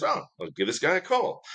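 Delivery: high, offhand mock-casual voice; breezy camp director. Camp director ringing at 3am, high breezy mock voice.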